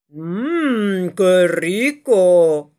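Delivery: very slow, loud drooling mmm; hungry dragon. Acted. Dragon León drooling over the rabbit, slow and greedy. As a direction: savor the word like a greedy beast. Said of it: "exclamó León mientras se le hacía agua".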